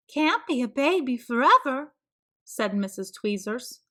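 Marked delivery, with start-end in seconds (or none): 0.1-1.9 high, prim neighbour voice; a busybody's high voice; nosy neighbour voice. A narrator's reading. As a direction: play the meddling neighbour, higher.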